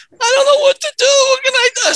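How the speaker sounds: mock distressed cry; panicked whine. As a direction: imitate a man at his wits' end. Customer panicking with no clothes.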